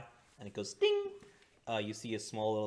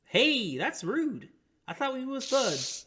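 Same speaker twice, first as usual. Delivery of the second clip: high, loud, mock-hurt innkeeper; mock offended innkeeper; 8 dB louder, slower than usual. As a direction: play at being wounded.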